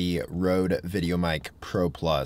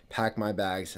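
ordinary talk, nothing marked

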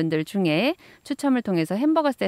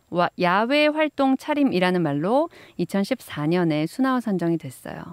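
normal talking voice

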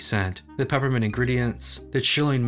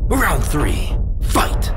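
loud, higher-pitched announcer call; booming fighting game announcer; 3 semitones higher, 8 dB louder, much slower than usual. Acted. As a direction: boom it out like a fighting game referee. Fighting game style round call.

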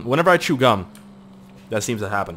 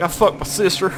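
high put-on yokel voice with silly laugh; mock inbred driver; 8 semitones higher than usual. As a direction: lightly imitate the guy driving, then chortle. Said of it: "Look at that fucking guy driving it".

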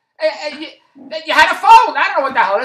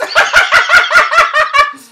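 a high, loud burst of laughter; high shrieking laugh; 9 dB louder than usual. Real, not acted. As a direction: crack up with a squeal. Cracking up at own story.

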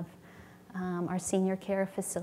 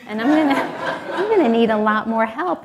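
louder, breaking into a laugh; laughing punchline; 2 semitones higher, 11 dB louder than usual. Retold or imitated. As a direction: deliver the punchline through a laugh. Punchline of retold prayer, laughing.

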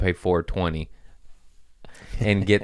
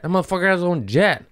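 excited; 11 semitones higher, faster than usual. Real, not acted. Envious exclamation about jet.

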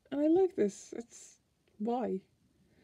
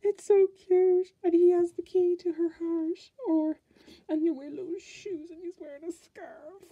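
squealing delight; 8 semitones higher, 8 dB louder than usual. Real, not acted. Gushing to viewers over penguin card, squealing.

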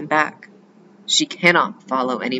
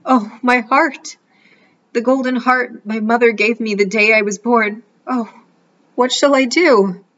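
cartoon princess despairing; 8 semitones higher, 4 dB louder than usual. A narrator's reading. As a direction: cry out for the lost heart. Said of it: "She is quite inconsolable".

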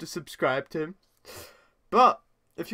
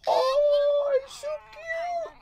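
cutesy gushing; much slower than usual. Real, not acted. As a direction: coo over a puppy. Gushing over a puppy.

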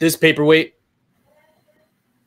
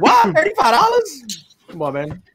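surprised; 10 semitones higher than usual. Retold or imitated. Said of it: "So I was just like".

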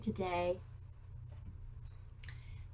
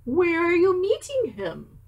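1 curious fox; 8 semitones higher, 4 dB louder than usual. Acted. Fox asks mouse.

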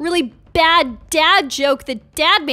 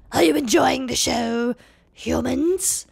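softening sinister villain voice; taunting villain; 2 semitones lower than usual. Acted. Villain Skeletox addresses the humans, taunting. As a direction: taunt them like a creepy villain.

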